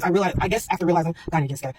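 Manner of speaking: usual speaking voice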